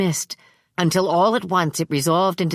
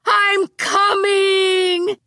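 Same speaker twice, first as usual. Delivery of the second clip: much higher, louder, calling from far away; tired shout back; 4 dB louder, much slower than usual. Acted. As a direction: shout it back without urgency. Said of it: "she shouted back, tiredly".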